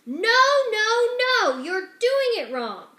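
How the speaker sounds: loud, high outburst; loud, high scolding shout; frantic shout. Acted. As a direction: shout them down in exasperation. Roscuro keeps shouting.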